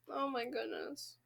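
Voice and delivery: stunned hush. Real, not acted. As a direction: breathe it out in shock. Stunned reaction.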